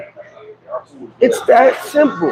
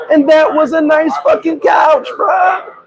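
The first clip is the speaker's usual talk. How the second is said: mock outrage; 7 semitones higher, slower than usual. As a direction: yell it in fake indignation. Mock indignant couch defense.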